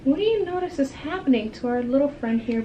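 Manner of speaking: ordinary delivery, nothing marked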